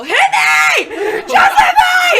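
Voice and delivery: high, loud yelled taunt; mock shout, stretched out slowly; imitating yelling. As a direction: yell it like a wild kid in the pit. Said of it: "start yelling at people".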